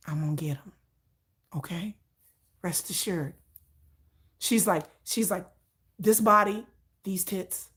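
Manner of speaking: low, soft and matter-of-fact; quietly certain she'll get him; low steady deadpan insisting; low, calm, determined friend. Retold or imitated. Friend voiced.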